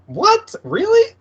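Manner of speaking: incredulous. Retold or imitated. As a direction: squeak out your disbelief. Incredulous at the doctor.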